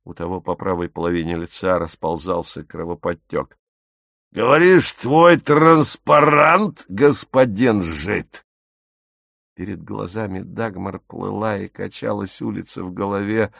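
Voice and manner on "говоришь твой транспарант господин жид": loud, drawn-out mocking question; high, raised start sinking into a slow sneer; menacing taunt. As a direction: taunt the victim slowly and loudly. Squad leader taunts his beaten victim.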